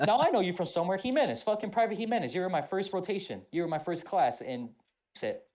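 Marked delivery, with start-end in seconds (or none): 0.1-4.4 drill sergeant. Retold or imitated. Drill sergeant voiced in story.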